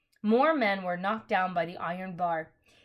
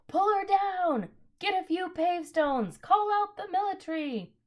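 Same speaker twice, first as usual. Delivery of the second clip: angry crowd roar; 10 semitones higher than usual. A narrator's reading. Angry crowd yelling at the witch, furious roar. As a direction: rouse the mob to pull her down. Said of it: "There came an angry roar from the crowd".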